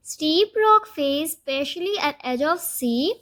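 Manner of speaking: very slow, word by word for note-taking; slow dictation. Shown as a demonstration. Teacher to students, dictating a meaning very slowly. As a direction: spell out the meaning slowly so students can write.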